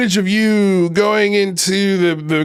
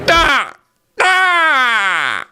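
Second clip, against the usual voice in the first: mock angry yell; 6 semitones higher than usual. Mocking angry scream.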